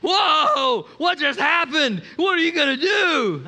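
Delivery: loud, high, alarmed cries; panicked shout. Retold or imitated. Friend panicking at threat.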